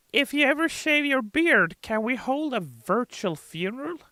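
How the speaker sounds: high fan voice. Retold or imitated. Reading a fan's question about his beard, high. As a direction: ask it like a mourning fan. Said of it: "Gidget the Goblin says".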